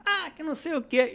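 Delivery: parents yelling. Retold or imitated. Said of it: "Os pais começaram a gritar".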